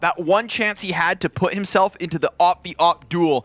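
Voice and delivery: dramatic caster with punched, stretched key words; live play-by-play. Real, not acted. Caster dwells on the dropped op shot, dramatic play-by-play. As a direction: hammer home the missed chance.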